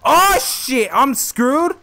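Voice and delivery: high loud panicked cry. Real, not acted. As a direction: cry out in alarm. Chased, panic.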